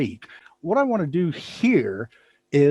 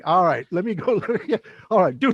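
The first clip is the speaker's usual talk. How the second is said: ordinary talk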